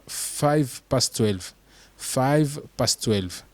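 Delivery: past said "pas" linked, slowly; T of past dropped. Shown as a demonstration. Teacher showing learners the native form, slow. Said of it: "ne prononcez pas le T qui est à la fin du past".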